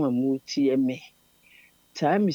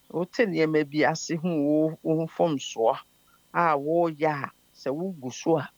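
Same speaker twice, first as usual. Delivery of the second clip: ordinary delivery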